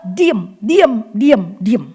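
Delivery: voiced, stern diam, diam with falling ends; firm hush. An aunt firmly telling a quarreling niece to hush.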